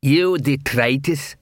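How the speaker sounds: slow, lightly voiced troll sneer; a dim troll mocking a comrade. Troll to Detritus, lightly voiced sneering.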